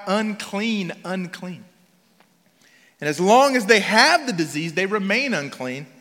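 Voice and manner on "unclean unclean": ordinary delivery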